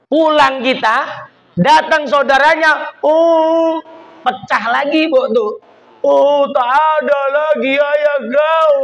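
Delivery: high, slow mock wail for the dead father; mock wailing lament. Relatives wailing at a funeral. (ratapan keras meniru kerabat yang berduka)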